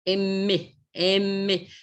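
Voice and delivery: "M" hummed through closed lips; phonics demo. Shown as a demonstration. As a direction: hold the "M" hum. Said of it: "um outro tipo de som". ("M" nasal, lábios fechados)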